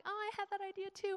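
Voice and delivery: high, soft, small voice; mock wistful mother. Mother answers daughter who shares her idea, high and small.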